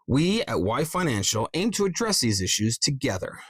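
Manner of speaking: "we" and "together" emphasized in a script read; stress on we and together. Shown as a demonstration. Voice coach shows how a script line is emphasized, stressing "we" and "together". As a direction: lean on "we" and "together". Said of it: "See how it emphasizes that sentence".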